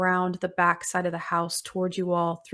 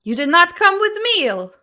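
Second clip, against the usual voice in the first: put-on booming old witch; 11 semitones higher, 12 dB louder than usual. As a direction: boom it out as the giant crone. GM voices Baba Yaga.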